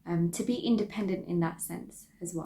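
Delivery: ordinary delivery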